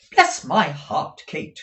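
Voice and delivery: loud, high, slow outburst; drawn-out, emphatic cry of surprise; sudden exclamation. A narrator's reading. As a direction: exclaim it as a startled mother. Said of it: "with a sudden".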